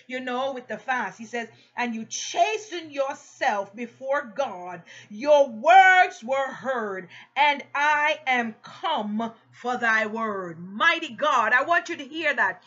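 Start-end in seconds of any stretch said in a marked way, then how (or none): 1.8-10.6 slow declamation swelling loud on "Your words"; emphatic, stretched pulpit reading; solemn scripture reading. A narrator's reading. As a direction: proclaim the angel's message, drawing words out. Reads scripture to congregation.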